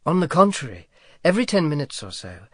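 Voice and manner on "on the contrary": ordinary delivery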